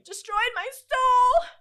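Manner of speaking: high, slow, overwrought lament; mock wounded wail. Telling her best friend, a high slow mock wail. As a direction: moan it like a hammed-up tragedy. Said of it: "I'll tell her".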